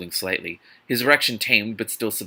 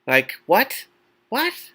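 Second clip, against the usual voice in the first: incredulous squeak; 11 semitones higher, 3 dB louder, much slower than usual. Real, not acted. Incredulous reaction to the scene.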